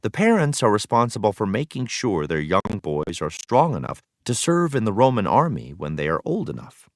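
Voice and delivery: ordinary talk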